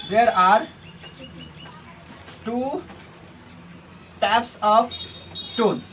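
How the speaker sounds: very slow, word-by-word dictation. Real, not acted. Teacher dictating a line for students to copy, slow dictation. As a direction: draw it out in small chunks for writing down.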